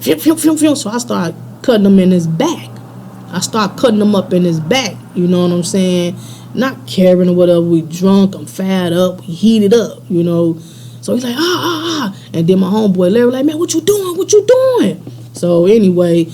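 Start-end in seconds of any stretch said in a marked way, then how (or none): 0.0-0.8 quick high swishing noises; whooshing blade sound effect; imitated slashing swishes. Imitates box cutter slashes. 11.4-12.2 high, repeated mock yelps; short high-pitched "ah" cries; imitated pained cries. Imitates man being cut. 13.4-15.0 high-pitched, alarmed questioning; retold alarm, pitched way up; alarmed friend. Acts alarmed homeboy intervening.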